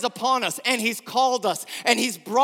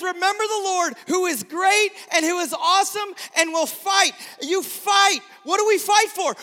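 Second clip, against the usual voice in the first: preaching at full force; 8 semitones higher than usual. Acted. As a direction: rouse them like a war speech.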